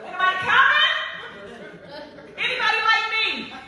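high, loud cry for anyone to come; loud pleading call into the empty; desperate calling out. Retold or imitated. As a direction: call out as if stranded and alone. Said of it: "I'm like".

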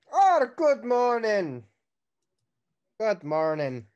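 a hint of an unplaced accent, drawn-out greeting; odd NPC accent. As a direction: greet them in a vague put-on accent. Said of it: "Not sure what that accent was".

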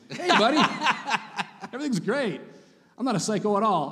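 high, bright put-on cheer with a laugh; fake cheerful dad. Dad fakes calm cheer for his son, high and laughing. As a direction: act the calm happy dad while fuming. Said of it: "pretending to be the dad that he thinks I am".

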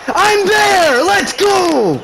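very high, loud victory yell; triumphant shout. Real, not acted. Gamer celebrating his defensive play, high loud yell.

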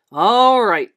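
big, booming, relieved call; loud, jolly exclamation; hearty Santa. A narrator's reading. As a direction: boom it out as Santa. Santa takes charge again.

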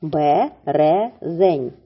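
"Березень" stretched very slowly, sound by sound; very slow word. Shown as a demonstration. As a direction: draw out "Березень" so each sound is heard. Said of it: "схему цього слова".

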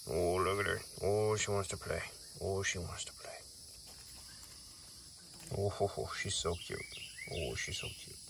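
soft, doting coo; affectionate cooing. Real, not acted. Keeper watching an animal who wants to play, cooing. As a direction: coo quietly over a cute animal.

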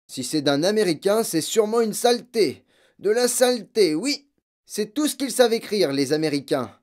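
high, loud contemptuous tirade; ranting father. Acted. Father ranting against American writers, loud and scornful. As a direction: rant with scorn.

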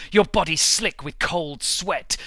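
usual speaking voice

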